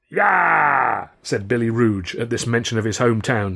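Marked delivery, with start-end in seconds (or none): 0.1-1.1 aggressive cheer. A narrator's reading. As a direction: shout like picking a fight. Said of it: "a cheer with what sounded like the offer of a fight".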